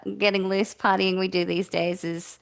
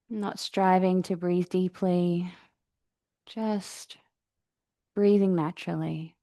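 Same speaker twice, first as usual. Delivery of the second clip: calm, even meditation voice with long pauses; guided meditation; 3 dB quieter than usual. Real, not acted. Yoga teacher settling the class into breathing practice, guided meditation. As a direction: guide them gently into the breath, unhurried.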